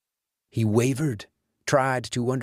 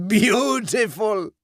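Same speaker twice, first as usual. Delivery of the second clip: ordinary delivery, nothing marked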